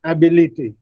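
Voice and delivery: "ability" wrongly said AB-i-li-ty; mispronounced stress. Shown as a demonstration. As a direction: open "ability" with a full, stressed AB. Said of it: "pronounce this word as".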